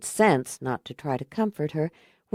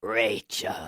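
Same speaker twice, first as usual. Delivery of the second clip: a hint of a low, thick growl; thick monster voice; 8 semitones lower, 4 dB quieter than usual. A narrator's reading. Eric mid-transformation calling Rachel, lightly voiced thick growl. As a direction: growl the name through a changing throat. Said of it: "thick as mud".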